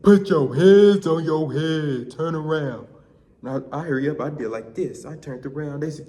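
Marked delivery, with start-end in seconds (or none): 0.0-2.9 slow, loud officer's order, easing off at the end; retold police command. Police order the runaway to surrender, shouting. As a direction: imitate a cop shouting orders.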